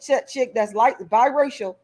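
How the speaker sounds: normal talking voice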